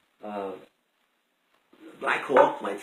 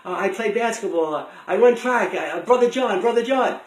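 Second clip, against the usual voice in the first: put-on high, rushed, flustered kid's excuse; blurted out nervously; 8 semitones higher, 6 dB louder than usual. Boy caught near police car blurts his innocence, nervously rushed. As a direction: babble it out like a scared schoolboy. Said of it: "And I blurted out".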